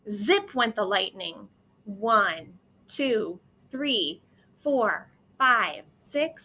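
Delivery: ordinary delivery, nothing marked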